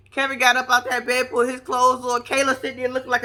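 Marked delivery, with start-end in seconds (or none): none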